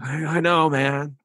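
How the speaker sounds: sheepish tenant in a high mock New York accent. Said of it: "New York accent".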